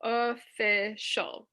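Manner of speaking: "Elastic" dragged out very slowly; reading a student's word aloud; slow syllables. Shown as a demonstration. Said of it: "And Jessica".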